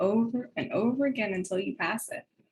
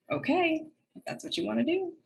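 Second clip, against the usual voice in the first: higher, doubtful okay, tune lifting; skeptical okay; 7 semitones higher than usual. Retold or imitated. Mentor lets her choose, skeptical and questioning. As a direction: let them go ahead, with audible doubt. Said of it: "constantly hear that".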